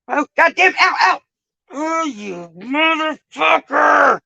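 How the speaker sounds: slow, high furious shout at the pain; drawn-out pained shout. Real, not acted. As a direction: bellow the curse as it hurts.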